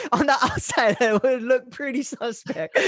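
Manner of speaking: talking through high laughter; can't stop laughing at the memory. Real, not acted. Telling his host about the parking lot, laughing.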